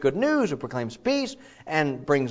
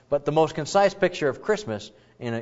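normal talking voice

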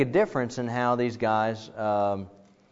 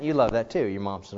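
usual speaking voice

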